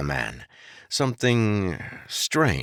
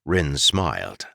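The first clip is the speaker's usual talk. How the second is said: ordinary delivery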